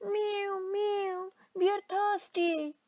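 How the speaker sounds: high, drawn-out mewing plea; thirsty cats. A narrator's reading. Cats crying to the old man for water, high plaintive mewing. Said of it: "cried the hundreds of cats".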